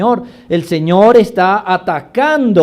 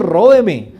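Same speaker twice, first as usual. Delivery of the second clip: normal talking voice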